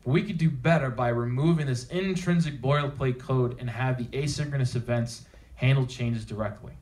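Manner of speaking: normal talking voice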